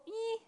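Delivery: high, soft nervous ee; a small tight worried noise; anxious squeak. Acted. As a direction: squeak out the unease. Describing feeling uneasy.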